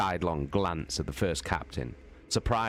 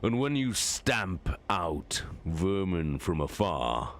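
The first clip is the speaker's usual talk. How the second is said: Horus the warlord. Acted. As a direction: weigh each word like a general passing sentence. Horus explains his contempt.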